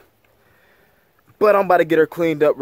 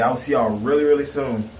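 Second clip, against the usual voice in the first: ordinary talk, nothing marked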